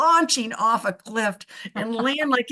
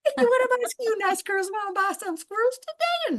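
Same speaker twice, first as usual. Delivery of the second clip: much higher, sing-song saleswoman pitch; Kathy Bates voice; 11 semitones higher than usual. Retold or imitated. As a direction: hawk squirrels like an overeager roadside seller. Kathy Bates selling squirrels, retold.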